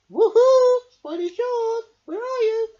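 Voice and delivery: high, loud calling voice. A narrator's reading. Angel Fish calling for the missing shark, loud and high. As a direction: call far across the water. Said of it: "called Angel Fish".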